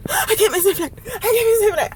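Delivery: high-pitched tearful mock panic; frantic whining. Late passenger running for the gate, frantic. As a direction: wail it high while rushing.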